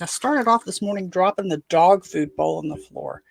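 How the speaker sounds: ordinary talk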